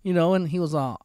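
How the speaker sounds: normal talking voice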